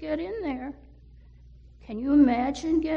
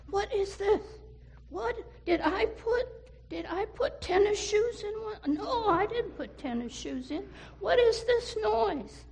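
puzzled; 8 semitones higher than usual. Acted. Mother puzzled by thumping.

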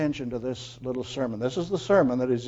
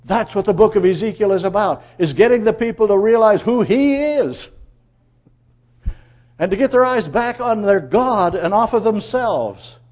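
raised, loud sermon climax; forceful preaching; 6 semitones higher, 8 dB louder than usual. Real, not acted. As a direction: drive the point home to the congregation. Preaches the book's point at force.

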